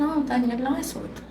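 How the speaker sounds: bem mais baixo, quase para dentro; médico tranquilizador, imitado. Retold or imitated. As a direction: baixe a voz como um médico dando o resultado. Médico tranquiliza mãe após exame. (médico acalmando a mãe em voz baixa)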